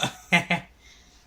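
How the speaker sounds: loud, high burst of laughter. Real, not acted. Streamer laughing at a bad verdict, loud laugh.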